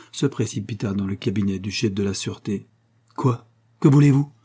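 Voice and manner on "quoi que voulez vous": sharp, louder demand, rising high; startled cry. Acted. Chief confronting a bursting-in stranger, startled loud cry. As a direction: bark it at a man he takes for a madman. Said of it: "s'écria Molescott".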